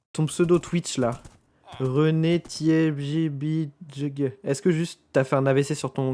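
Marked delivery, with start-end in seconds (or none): 1.8-4.4 very slow, loud syllable-by-syllable reading; laboured sounding-out. Real, not acted. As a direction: stretch each syllable of a baffling name. Déchiffre un pseudo imprononçable. (déchiffrage lent, syllabe par syllabe)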